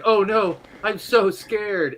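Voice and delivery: high, hammed-up mock terror; badly faked fear. Armor pantomiming fear to the party, badly faked fright. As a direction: fake being scared like a bad actor. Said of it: "starts faking and pretending".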